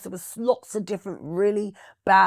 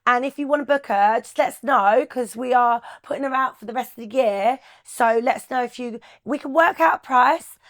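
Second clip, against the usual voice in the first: higher, louder, chirpy Cockney sales pitch in a put-on voice; fake Cockney accent; 5 semitones higher, 6 dB louder than usual. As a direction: play a Cockney booking agent selling her act on the phone. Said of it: "I used to put a really Cockney accent on".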